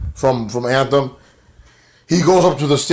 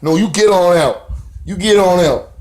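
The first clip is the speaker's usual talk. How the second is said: raised-pitch, punched dismissal; mock angry order; 6 semitones higher than usual. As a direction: order him out, hammed-up. Husband ordering Garza to leave.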